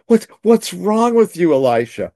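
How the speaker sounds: high, loud disbelieving cry; alarmed servant. Retold or imitated. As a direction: sound shocked at his calm. Panicked servant questions Elisha.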